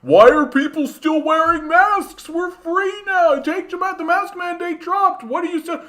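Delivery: very high, loud mocking voice of an anti-masker. Mocking anti-maskers online in a high squeaky voice. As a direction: squeak it out like a smug anti-mask type. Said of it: "being like".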